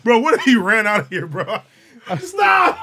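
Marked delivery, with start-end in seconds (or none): 2.2-2.8 mock shout. Imagining him run out.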